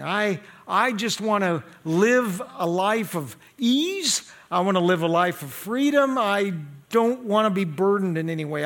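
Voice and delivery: rising, insistent run of refusals; burden-free churchgoer. Acted. Preacher voicing people who want no burdens, insistent mimicry. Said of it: "for a lot of people, their goal is".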